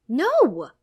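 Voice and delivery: high, very loud bark; barked denial. A narrator's reading. Mother denying child's accusation, sudden bark. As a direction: snap back instantly at full voice. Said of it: "she instantly barks back".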